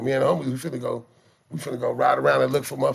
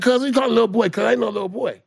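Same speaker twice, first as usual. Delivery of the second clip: high, loud talking back; defiant teen; 7 semitones higher, 4 dB louder than usual. Retold or imitated. Teen defying the grown man, high and loud. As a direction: push back hard.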